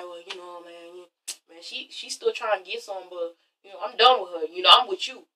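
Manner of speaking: low, barely audible mumbled impression; badly faked accent, very soft and low. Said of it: "that's not even how he sounds".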